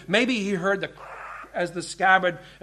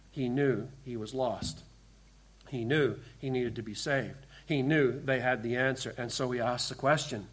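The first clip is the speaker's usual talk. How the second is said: hushed preaching; 7 semitones lower, 10 dB quieter than usual. Acted. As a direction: drop the voice right down, much softer and lower.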